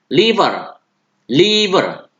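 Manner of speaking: "liver" jelas /livər/; model word. Shown as a demonstration. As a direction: contohkan "liver". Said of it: "ulangi seperti yang saya ucapkan". ("liver" diucapkan jelas)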